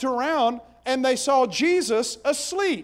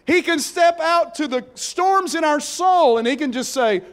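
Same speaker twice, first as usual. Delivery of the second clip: louder, higher rising sermon climb; preaching at full force; 4 semitones higher, 5 dB louder than usual. Acted. Preacher to congregation about storms in the soul, full-force preaching. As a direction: build the congregation to a shout of hope.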